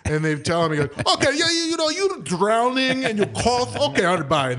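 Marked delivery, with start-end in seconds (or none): 1.1-4.5 very high, hammed-up Ukrainian accent; imitated brusque doctor with a Ukrainian accent; abrupt Ukrainian doctor. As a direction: brush the patient off in a mock Ukrainian accent. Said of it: "he was abrupt".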